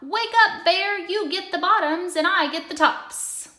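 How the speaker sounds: very high, loud wake-up shout; calling out. A narrator's reading. Hare calls Bear at the second harvest, loud high shout. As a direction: holler Bear awake. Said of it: "Hare called out".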